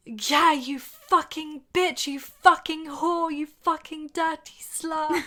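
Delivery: loud, slow, hammed-up shouting of insults; mock yelling the crude lines in bed; retold shout. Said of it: "he shouts".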